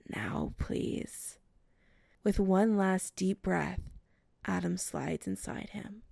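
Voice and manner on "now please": low breathy plea; whispering. Acted.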